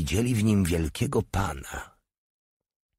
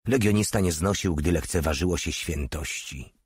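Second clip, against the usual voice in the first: usual speaking voice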